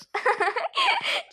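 squeaky high laughing; high giggle. Acted. Friend finds the scolding funny. (tiz kıkırdama)

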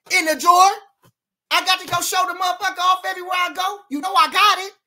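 high, loud outburst; shouting. Real, not acted. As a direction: belt it out at the nosy crowd. Hyped up telling off nosy fans.

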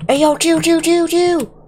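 squealed, held-high protest; high-pitched pleading. Real, not acted. Gamer begging an attacker to stop, high-pitched pleading. Calling out under attack in game.

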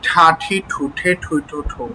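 slow, measured syllable recitation; slow drill reading. Shown as a demonstration. Teacher reading the last practice line for learners, slow reading. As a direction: give every syllable its own time. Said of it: "এখন এটা তো স্বাভাবিক পড়া".

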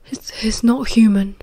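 understated shaky stammer; a touch shaken, halting at the start; shakily. A narrator's reading. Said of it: "he said shakily".

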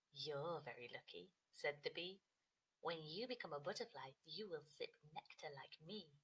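low, soft bee, unhurried. Acted. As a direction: bee gently telling the caterpillar about nectar. Bee talks to caterpillar.

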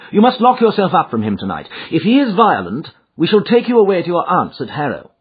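high, forceful detective voice; emphatic, businesslike sleuth; Holmes, resolute. A narrator's reading. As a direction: give firm protective instructions. Holmes instructs his client.

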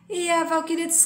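usual speaking voice

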